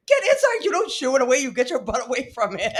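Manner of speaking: high, frantic retold order; freaking out. Partner ordering husband away from the skunk, mock-panicked. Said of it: "you were freaking out".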